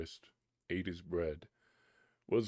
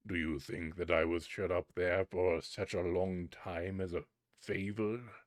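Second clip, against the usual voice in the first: mighty spirit. Acted. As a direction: proclaim his power and the doom. Spirit declares the boy's fate.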